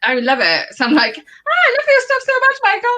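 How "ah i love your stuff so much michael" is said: very high fangirl squeal; gushing fan. Acted. Fan commenting to Michael Spicer, high gushing squeal. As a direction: squeal it adoringly, mock-starstruck.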